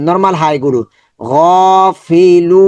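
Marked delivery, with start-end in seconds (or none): 1.2-2.6 গাফিলুন with final লুন held long; long madd. Shown as a demonstration. Teacher reciting verse ending, drawn-out chant. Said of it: "খুব দ্রুত দেখাচ্ছি".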